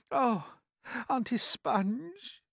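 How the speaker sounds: put-on high small-boy voice, crying out; pleading cry. Unhappy boy appealing to his aunt, high pleading cry. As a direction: call out imploringly in a high child's voice. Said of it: "James cried out".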